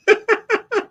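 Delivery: squeaky high laugh. Real, not acted. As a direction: laugh at him. Laughs at Brian.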